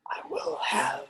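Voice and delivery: high, whispered ghostly threat; seething shrieking spirit. Acted. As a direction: hiss it like a vengeful spirit. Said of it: "and say".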